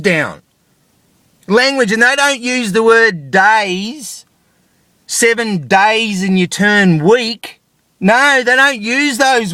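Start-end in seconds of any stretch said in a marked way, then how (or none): none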